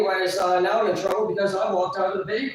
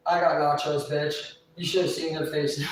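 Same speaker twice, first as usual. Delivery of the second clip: ordinary talk, nothing marked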